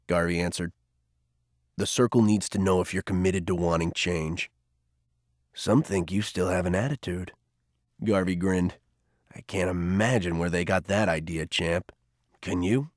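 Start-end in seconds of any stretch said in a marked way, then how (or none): 1.8-12.9 put-on much lower and softer, wry older man; Garvey's dry low voice. A narrator's reading. Garvey teasing Cole about his attitude, low and wry. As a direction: tease him gently, dry and unhurried.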